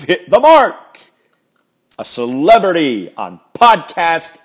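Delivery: rally the fans, pumped up; hyped appeal. Real, not acted. Host begging listeners to make him a podcast celebrity, excited and loud. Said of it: "pleading for podcast fame".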